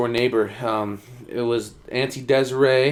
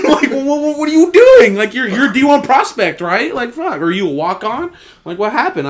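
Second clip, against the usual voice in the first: loud, high, mock disbelief; incredulous; 12 semitones higher, 9 dB louder than usual. Teammate to struggling D one transfer, incredulous. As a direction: question them as if you cannot believe it.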